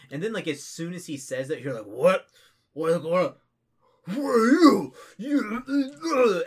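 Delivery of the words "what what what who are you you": loud, high, strained screaming; frantic acted yelling; panicked outburst. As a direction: shout it wildly, climbing into a shriek. Sudden alarmed outburst as voice booms.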